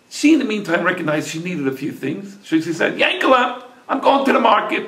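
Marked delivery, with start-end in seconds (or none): 3.0-4.9 calling wife. A narrator's reading. Wife calls out to husband.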